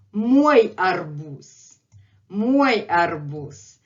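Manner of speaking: slow, drawn-out "Мой арбуз"; slow model phrase. Shown as a demonstration. Teacher says it again for learners, slowly and deliberately. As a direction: stretch "Мой", end "арбуз" on a voiceless s. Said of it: "Let's say it again".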